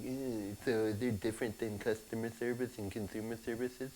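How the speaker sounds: soft, nasal-free mock whine of a young coworker; whiny millennial. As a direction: play the pedantic millennial correcting. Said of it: "my millennial on the other side is gonna be like".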